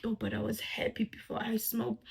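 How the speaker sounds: a soft unvoiced whisper of regret. The stoned teen, whispering regret to himself. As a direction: whisper it like a sad realisation.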